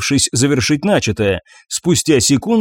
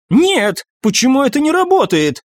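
banshee voice; 11 semitones higher than usual. Acted.

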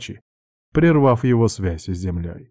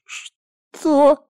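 shocked squeak; 4 dB louder than usual. Acted. Shocked at threat.

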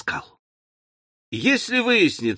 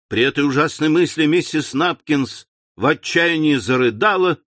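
ordinary delivery

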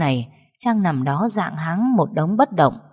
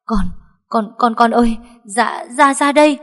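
terrified stutter climbing higher and louder; stammering panic; 6 semitones higher, 5 dB louder than usual. Acted. Grandmother stammers to her son about the fall, panicked. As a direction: blurt it out in shock. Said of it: "miệng ú ớ".

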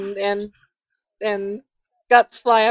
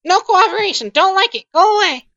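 high-pitched, short refusals from a grumpy character; grumpy shut-in; 8 semitones higher, 4 dB louder than usual. Acted. Resident refusing to talk to investigators at the door, grumpy high voice. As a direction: send them packing in a high voice. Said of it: "you hear someone say".

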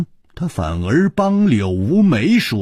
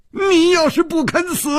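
much higher shouted threat; furious shout. A narrator's reading. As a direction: yell it at him furiously.

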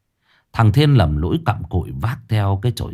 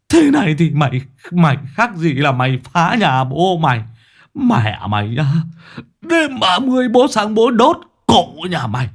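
higher, louder, slowed furious wailing curses; shrieking curses; 6 semitones higher, 3 dB louder than usual. Acted. Old Thấm sitting on the ground cursing Thưởng, shrieking wail. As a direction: rage and lament at the wrecker, dragging the words. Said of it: "chỉ tay rít lên".

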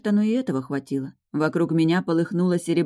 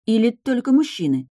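high-pitched indignant follow-up question; 7 semitones higher, 4 dB louder than usual. A narrator's reading. Heroine pressing the Supreme about the mark, indignant. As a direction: push the question up in protest. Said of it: "возмущённо уточнила я".

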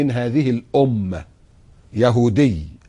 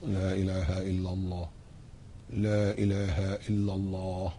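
very soft, low لا إله إلا الله; under the breath; 5 semitones lower, 13 dB quieter, slower than usual. Shown as a demonstration. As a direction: murmur it so nobody sees the lips move. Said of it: "شفايفك ثابتة أهي".

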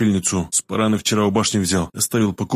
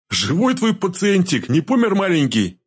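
high, drawn-out taunt; mocking; 9 semitones higher, slower than usual. A narrator's reading. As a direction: tease as if cooing over a baby. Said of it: "с издёвкой спросил знахарь". (с издёвкой)

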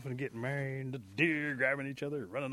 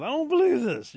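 very high, loud wailing disbelief; moaning protest; 12 dB louder than usual. Acted. Possum sees Rabbit's new wife, moaning in protest. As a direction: wail it out, hammed-up and hurt. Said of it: "starts to moan and cry".

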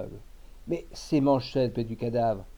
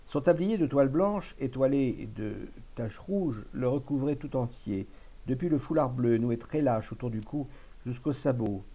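usual speaking voice

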